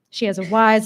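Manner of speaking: ordinary delivery, nothing marked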